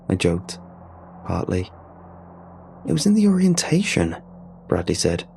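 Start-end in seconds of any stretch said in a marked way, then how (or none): 2.9-4.2 sharp, irritated retort, pitched up. Acted. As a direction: snap it back with open irritation. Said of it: "not trying to hide his irritation".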